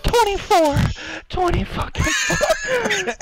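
very high, crying-out rage; high anguished yell at the teammate; anguished shout. Real, not acted. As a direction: wail the number in real despair. Answering teammate, still raging.

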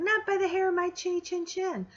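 high yelled pig voice; a hint of a defiant little pig. Teacher voicing the pig shouting back at the wolf. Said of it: "that little pig yells back out".

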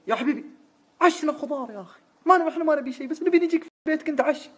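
إلحاح بصوت عالٍ. Retold or imitated. الفضل يلح بصوت مرتفع. (الفضل يلح على محمد بصوت حاد مرتفع)

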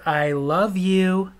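wife repeats it very slowly; slow drawn out. Retold or imitated. Wife repeating herself, slow and drawn out. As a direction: stretch each word out. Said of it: "I said".

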